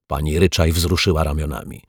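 ordinary delivery

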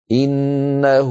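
إنه drawn out as innahū with a long ū; lengthened innahū. Shown as a demonstration. Teacher pointing to the red wāw: إنه as innahū. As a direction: hold the final vowel of إنه. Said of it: "لوناها بالأحمر".

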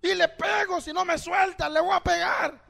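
high, loud, heated threatening; patient's shouted threat. Acted. Preacher plays hurting patient threatening the doctor, shouting. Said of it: "le está gritando al doctor".

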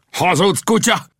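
high, outraged cry; high-pitched burst of anger; angry shout. A narrator's reading. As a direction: shout it as a furious accusation. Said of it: "Kiáltotta az indián".

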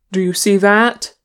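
loud call with rising question. A narrator's reading. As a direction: call to companions to look. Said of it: "Aragorn called".

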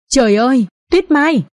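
understated cry, leaping high on the name; horrified shout. A narrator's reading. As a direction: cry out to an injured lover, lightly acted. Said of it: "Anh Tuấn hét lên".

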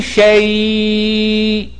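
شيء stretched with a full long ي before the hamza; slow model word. Shown as a demonstration. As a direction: linger on the ي in شيء. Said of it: "نطقت الياء كاملة".

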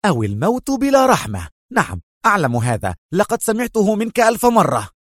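high-pitched, exasperated outburst; heated, fed-up retort; sharp interruption. A narrator's reading. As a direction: cut in hotly, pitch leaping. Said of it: "قاطعه في حدة أكثر".

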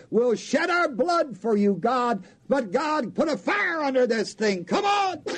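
rising, ending in a shout; mock desperate plea. Prophets of Baal pleading with their god, ending in a shout. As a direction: beg the god, then demand.

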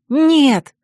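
joyful refusal. A narrator's reading. Heroine jumps up with a new plan, joyfully. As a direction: cry it out in delight. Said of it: "радостно подпрыгнула я".

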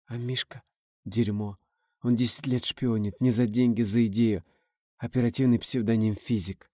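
drawn out gently, almost breathless; a fading, loving murmur; tender drawl. A narrator's reading. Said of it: "ласково затянула Инна".

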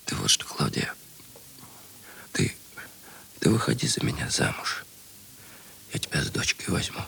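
low, whispered and halting; whispered nervous proposal to Klavdia. Acted.

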